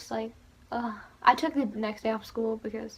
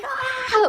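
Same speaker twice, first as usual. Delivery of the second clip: much higher, loud concert scream; fan scream; 13 semitones higher, 8 dB louder than usual. Retold or imitated. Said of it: "literally screaming".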